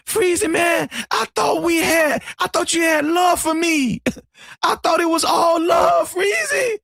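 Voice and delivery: high, wounded pleading in imitation; mock whining. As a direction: imitate a hurt friend whining for love. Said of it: "he was whining".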